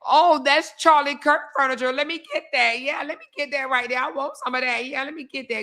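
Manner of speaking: mock someone grabbing for the furniture; eager mock buyer. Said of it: "Ain't nobody saying".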